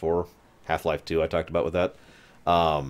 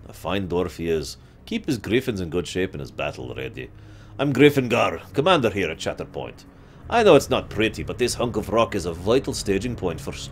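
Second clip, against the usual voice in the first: put-on a light Dwarven accent for the gryphon commander; 3 semitones higher than usual. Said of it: "so I just do a Dwarven accent".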